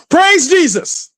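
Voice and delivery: high, slow mock exultation; hammed-up praise shout. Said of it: "and go".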